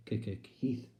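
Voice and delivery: "K-K-Keith" stuck on the first consonant; stammer. Acted. Sister calling her brother, stammered "K-K-Keith". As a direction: stutter the K before getting out "Keith". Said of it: "Elspeth's speech is imperfect".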